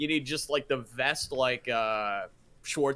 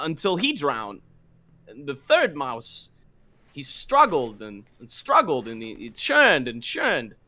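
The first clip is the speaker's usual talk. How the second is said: halting celebrity impression with long pauses; slow, punched words, broken by pauses; Christopher Walken. Friend showing off his go-to impression, halting Walken delivery. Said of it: "do a Christopher Walken".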